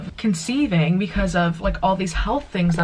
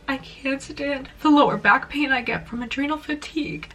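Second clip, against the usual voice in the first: crying; 5 semitones higher than usual. Real, not acted. Crying to viewers that she can't stand the pain. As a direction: let the tears come through each line. It's really good I just did my makeup.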